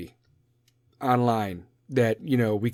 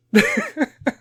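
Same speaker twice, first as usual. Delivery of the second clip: high laugh; 5 dB louder than usual. Real, not acted. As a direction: giggle at own joke. Laughs at own bit.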